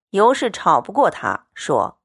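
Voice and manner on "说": usual speaking voice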